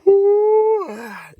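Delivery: long loud high sigh; big nervous sigh; heavy sigh. Real, not acted. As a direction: let out a big shaky breath. Nervous pause before asking viewers.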